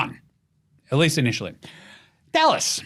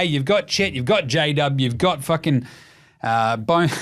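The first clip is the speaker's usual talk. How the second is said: ordinary talk, nothing marked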